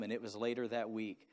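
ordinary delivery

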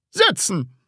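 high, one-word order; commanding. A narrator's reading. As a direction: command the class curtly. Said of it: "befahl er dann". (scharfer Befehl)

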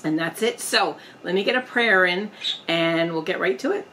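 usual speaking voice

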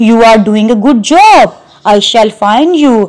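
normal talking voice

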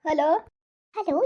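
usual speaking voice

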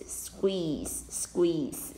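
"Squeeze" said softly, almost breathy; teacher modelling squeeze gently; soft model. Shown as a demonstration. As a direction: hush the word squeeze. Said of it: "当动词的话是squeeze".

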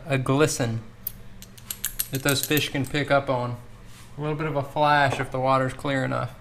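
talking through a face mask; muffled voice behind a mask. Real, not acted. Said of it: "sorry for the muffled tones because I've gotta wear this, this mask".

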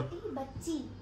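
whispering. Acted. Whisper challenge, whispered phrase.

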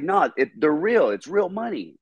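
high-pitched insistent protest; retold protest. Customer insisting to the bank teller, high-pitched protest. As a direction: plead your case, pitched well up. Pleading that the check is good.

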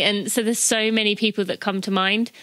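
ordinary delivery

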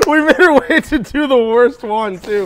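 high, slow, laughing tease. Real, not acted. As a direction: laugh it out at her. Teasing, laughing.

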